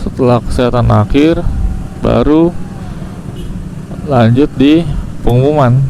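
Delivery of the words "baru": usual speaking voice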